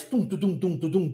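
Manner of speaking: low vocal drum roll; drummer's "tum tudum" imitation; imitated drum beat. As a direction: tap out a drum rhythm with the voice. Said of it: "o cara lá da bateria faz".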